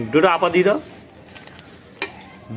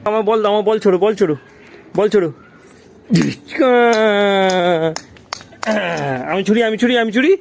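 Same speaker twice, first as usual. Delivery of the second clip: calling out in play; 7 semitones higher, faster than usual. Real, not acted. Playing ball, calling out. (খেলার মধ্যে জোরে ডাকা)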